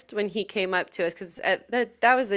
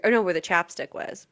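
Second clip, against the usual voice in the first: ordinary delivery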